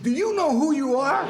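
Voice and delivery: high, loud, laughing through the question; cracking up while doing the sailor's amazed question; laughing imitation of a starstruck sailor. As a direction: squeal it out between laughs, mimicking the fan. Excited sailor quoted in story.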